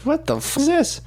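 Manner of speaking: high-pitched, incredulous aside; retold disbelief. Retells disbelief at teacher.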